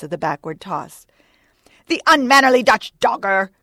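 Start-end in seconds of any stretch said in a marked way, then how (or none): 1.9-3.5 loud, higher indignant cry; Stubb. A narrator's reading. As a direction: hurl an insult across the water. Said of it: "cried Stubb".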